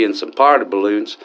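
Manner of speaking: ordinary talk, nothing marked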